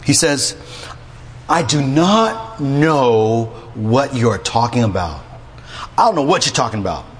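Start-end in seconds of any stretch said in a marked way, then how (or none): 1.5-5.2 slow, deliberate scripture-style reading; formal slow denial. Shown as a demonstration. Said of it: "that's how I might say it today". 6.0-7.0 fast, offhand modern brush-off; casual modern denial. Shown as a demonstration. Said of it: "that's how I might say it today".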